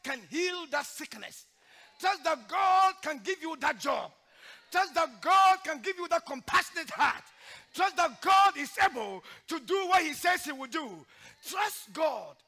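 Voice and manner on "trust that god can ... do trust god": loud, high preaching cry; preaching at full force. Acted. Preacher exhorting the church in a loud preaching cry. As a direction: drive each line home like a revival preacher.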